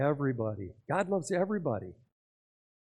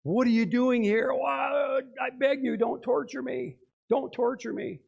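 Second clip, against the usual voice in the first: high shouting demon, begging; shrieking demon pleading with Jesus; 8 semitones higher than usual. Retold or imitated. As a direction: cry out as the demon possessing him. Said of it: "the demon speaks out instead".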